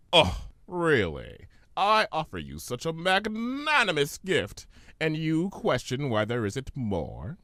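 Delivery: slow, theatrical indignation; mock offended genie. Discord feigning offense at Fluttershy, slow and theatrical. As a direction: play the wounded showman.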